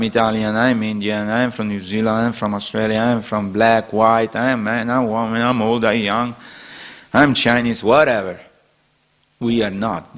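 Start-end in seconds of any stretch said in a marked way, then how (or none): none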